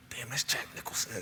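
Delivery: hushed, starstruck whisper; whispered in awe in the stands; awed whisper. Retold or imitated. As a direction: whisper it like you just spotted a star. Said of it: "I'm like".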